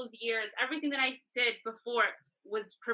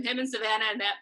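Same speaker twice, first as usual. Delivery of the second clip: ordinary talk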